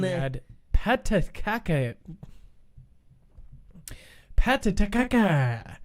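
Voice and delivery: put-on patataca said slowly in a light Japanese accent; lightly mock-Japanese patataca; a hint of Japanese accent; Japanese spin. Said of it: "I put a Japanese spin on that".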